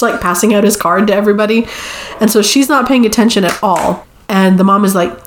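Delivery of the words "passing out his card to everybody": ordinary talk, nothing marked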